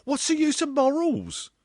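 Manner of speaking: put-on loud, very high girlish voice; girl's cry. A narrator's reading. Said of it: "cried the girl".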